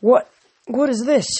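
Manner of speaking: stunned by what he sees; awed. Acted. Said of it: "awe in his voice".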